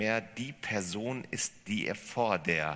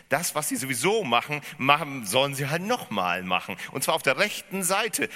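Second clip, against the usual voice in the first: laut und hoch, eindringlich gepredigt; predigend; 8 semitones higher, 8 dB louder than usual. Acted. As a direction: predige den Schluss mit Nachdruck. Preaching at full force. (laute, eindringliche Predigt)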